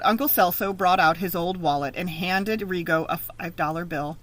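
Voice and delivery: ordinary delivery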